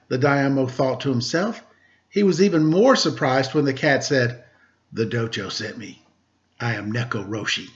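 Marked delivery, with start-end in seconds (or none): 4.9-7.7 a hint of a low, soft old cat master; calm, understated old cat samurai; lightly voiced old cat. A narrator's reading. Old cat introducing himself to the Daimyo, low and soft.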